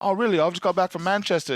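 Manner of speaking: lightly voiced chirpy streamer; light British accent. Storyteller voicing the streamer in light British. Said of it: "he's like".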